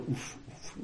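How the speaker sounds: soft voiceless puffs of breath; imitated last breaths. Friend's last breaths, imitated as soft voiceless puffs. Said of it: "trois souffles".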